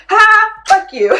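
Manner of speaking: high, loud mocking outburst; hammed-up gleeful jeer; mock taunt. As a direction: throw it out like a sudden slap. Voices the video attacking viewers.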